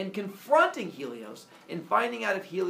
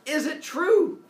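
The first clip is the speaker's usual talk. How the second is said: high, loud pleading question; urgent demand; 12 semitones higher, 8 dB louder, slower than usual. Acted. Son demanding the truth from his father, loudly. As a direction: push the question up and out.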